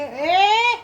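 mother scolding a son for throwing the puppy's things. Real, not acted.

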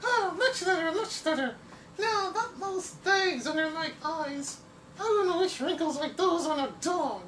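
very high, sweet falsetto damsel; swooning cartoon lady. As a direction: gush like a rescued heroine. Drawing now a lady character.

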